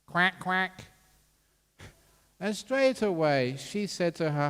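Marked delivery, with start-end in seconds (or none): 0.1-0.8 high-pitched mock duck quacks; short, high duck calls; imitated duck quack. Imitating a duck's call in story.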